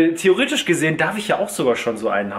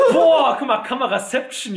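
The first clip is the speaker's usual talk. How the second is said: excited; 8 semitones higher than usual. Real, not acted. Amazed at two cameras.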